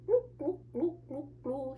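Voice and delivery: high little bubbly fish gloops; mouthing goldfish. A narrator's reading. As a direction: pop out tiny, high fish noises. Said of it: "he mouths".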